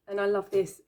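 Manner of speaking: usual speaking voice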